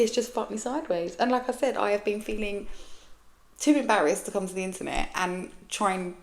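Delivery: normal talking voice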